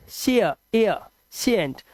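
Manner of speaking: syllable broken out as shi-a, i-a, shient; ia sound isolated. Shown as a demonstration. Teacher isolating a vowel sound, i-a glide in शेयर, एयर, शेंट. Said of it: "एयर की आवाज".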